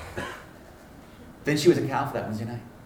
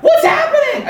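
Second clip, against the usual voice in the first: very high, loud comic panic; hammed-up imitation of a panicked yelp; mock freak-out; 14 dB louder than usual. Student trembling during prayer, imitated in comic panic. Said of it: "she's freaking out".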